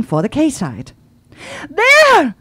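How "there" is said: high, loud excited cry; overexcited waitress crying out, high and loud; cried out. Acted. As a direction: point and cry it out. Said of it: "cried Laurine".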